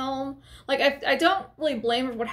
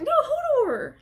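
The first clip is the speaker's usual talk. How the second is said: much higher, louder insistent cry; insistent excited; 14 semitones higher, 3 dB louder, slower than usual. Retold or imitated. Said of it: "I was so excited".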